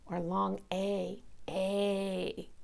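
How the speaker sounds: long A drawn out slowly; teacher stretching "A"; stretched long A. Shown as a demonstration. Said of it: "We stretch it out".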